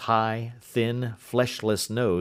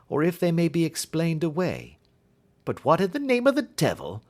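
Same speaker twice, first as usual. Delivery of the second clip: a lightly raised, brisk Holmes; 7 semitones higher than usual. A narrator's reading. Holmes asking Watson his view, lightly voiced. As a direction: lift the voice into Holmes, curious.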